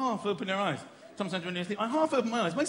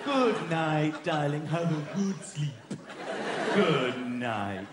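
low, slow, languid farewell; smug bedtime goodnight; 8 semitones lower, much slower than usual. Acted. Childless partner to darling, slow smug goodnight. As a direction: draw it out low and contented. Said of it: "This is how people without children".

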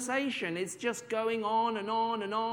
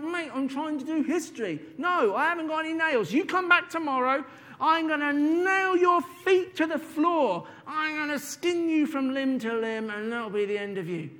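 higher, louder furious teacher; angry teacher threat; 7 semitones higher, 4 dB louder than usual. Acted. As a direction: threaten the squirrel, rising in anger.

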